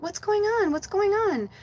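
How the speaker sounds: high, anxious repeated question; retold alarm. Owner seeing her cat can't rise, high worried alarm. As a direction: pitch up, kept small in retold panic. Retold shock at cat's state.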